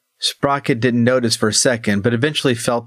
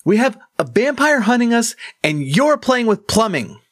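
raised, exasperated complaint; frustrated; 12 semitones higher than usual. Acted. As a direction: vent frustration at the gnome. Crethin arguing.

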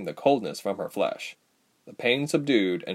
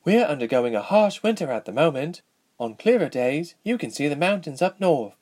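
put-on higher-pitched, informative young royal; lightly acted princess voice; young princess, explaining; 6 semitones higher than usual. A narrator's reading. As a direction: explain your homeland as its princess.

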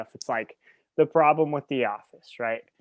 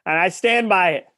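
defiant; 6 semitones higher, 9 dB louder than usual. Real, not acted. Defending bold claim playfully.

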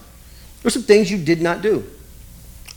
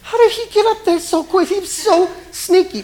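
much higher lightly voiced kids; high mock amazement; 12 semitones higher than usual. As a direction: squeal the surprise like a child. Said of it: "Everybody's like".

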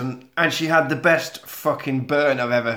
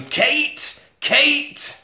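mock shouting; 11 semitones higher than usual. Jack calls Kate for more booze, mock shout. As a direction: call out in a pitched-up pretend shout. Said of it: "so he starts shouting".

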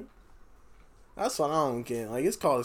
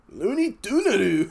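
mock Japanese accent; 5 semitones higher, 5 dB louder than usual. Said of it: "how to say Looney Tunes in Japanese".

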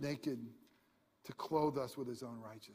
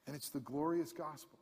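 ordinary talk

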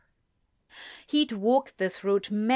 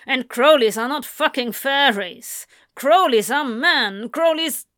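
louder, higher furious tirade; angry rant; 4 semitones higher, 7 dB louder than usual. A narrator's reading. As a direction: hammer the family name at him. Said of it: "James snapped".

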